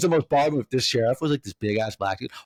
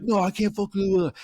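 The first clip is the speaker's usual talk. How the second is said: higher-pitched mock indignation; defiant protest; 8 semitones higher than usual. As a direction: imitate a man refusing to back down. Acted quarrel with security.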